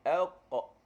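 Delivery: "elqa" dengan lantunan suara pada qaf. Shown as a demonstration. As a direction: pantulkan qaf dalam "elqa". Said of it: "saya sebut huruf qaf". (qaf bergema dalam "elqa")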